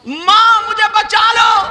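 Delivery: high, loud cry for help; acted scream, drawn high and loud; screamed plea. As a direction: shout desperately for mother to save you. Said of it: "چلانے لگی".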